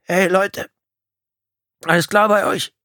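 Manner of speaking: high, loud worried call. A narrator's reading. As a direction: call to friends through the dark. Injured man calls into dark cabin.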